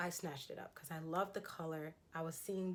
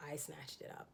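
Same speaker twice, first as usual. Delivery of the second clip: guilty-pleasure whisper, much softer; 3 semitones lower, 7 dB quieter than usual. Real, not acted. Telling viewers about the bargain, conspiratorial whisper. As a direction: confide it in a whisper.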